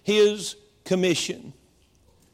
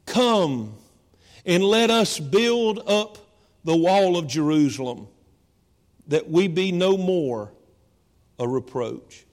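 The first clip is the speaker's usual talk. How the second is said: slow, solemn reading of the verse; slow scripture reading; 4 semitones lower, slower than usual. A narrator's reading. Pastor reading scripture to the church, slow and solemn. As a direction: let the words of the verse land one by one. Reads Nehemiah's call slowly.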